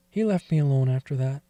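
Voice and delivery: ordinary delivery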